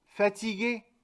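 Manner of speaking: fatigué with a clear French final é; French pronunciation demo. Shown as a demonstration. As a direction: model the French word for the class. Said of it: "les comparto también cuatro ejemplos".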